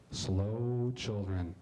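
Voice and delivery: slow, low, drawn-out reading of the sign; deadpan sign reading. Acted. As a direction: read "Slow children" like a flat road sign, very slow.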